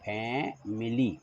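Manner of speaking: फैमिली said slowly as fae-mi-li; slow model pronunciation. Shown as a demonstration. Teacher slowly saying "फैमिली" for pupils to write. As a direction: spell out "फैमिली" slowly and clearly. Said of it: "उच्चारण के लिए".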